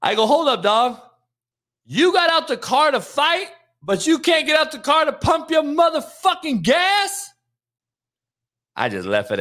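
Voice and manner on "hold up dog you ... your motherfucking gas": raised, higher, shouting it at him; a mock yell at the guy who let his girl pump the gas; heated retold yell. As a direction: call him out loud across the pump.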